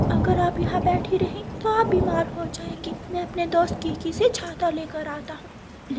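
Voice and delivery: put-on a kind child helping an old mother; lightly voiced little bird offering help; young bird Kuku.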